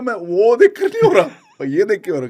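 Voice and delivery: loud laughing reply. Real, not acted. Husband answering his wife about crying, laughing loudly. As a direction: laugh through the explanation.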